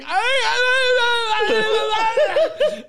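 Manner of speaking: long, high, loud mock sobbing; exaggerated bawling, drawn out; hammed-up wail. Comic re-enacting his breakdown at the promoter's news, hammed-up wailing.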